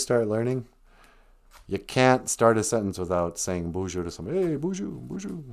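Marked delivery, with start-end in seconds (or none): 4.4-5.4 higher, soft singsong hello; eager beginner's greeting. Acted. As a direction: greet someone in a higher, softer learner's voice. Beginner learner greeting everybody eagerly.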